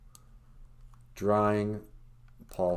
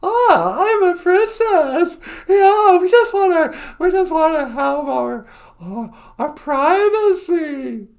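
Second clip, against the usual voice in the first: much higher and louder, slow, breathy princess pose; Meghan impression; 11 dB louder, slower than usual. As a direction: play a prim, precious princess asking for privacy. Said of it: "I always just had her".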